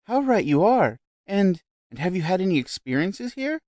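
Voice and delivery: high, loud eager exclaim; a society lady thrilled by the house; gushing delight. A narrator's reading. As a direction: burst in with excitement. Gushing guest.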